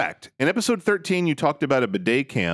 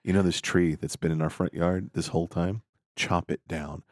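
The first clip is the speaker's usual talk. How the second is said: low, much softer bossy order; low gruff command; 9 semitones lower, 6 dB quieter than usual. Acted. Husband ordering his wife to chop the tree, low.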